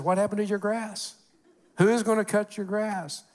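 high, mock-stern neighborhood letter; HOA letter voice. Pastor mocking the homeowners association letter, high prim voice. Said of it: "from the H O A that says".

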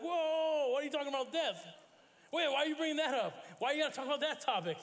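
high, loud mock-horrified Italian local; high, loud startled protest; alarmed Italian passerby. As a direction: play the spooked local backing away. Italians recoil from death talk.

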